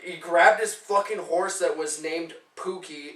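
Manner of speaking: ordinary delivery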